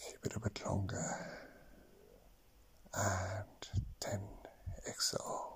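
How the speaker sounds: slow counting, whispered on exhales; slow breath counting. Acted. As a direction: count each breath calmly, long pauses. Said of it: "actually count your breaths now".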